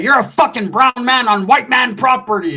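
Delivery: high, loud hillbilly outrage; acted angry outburst. Hillbilly ordering a trespasser off his property, angry.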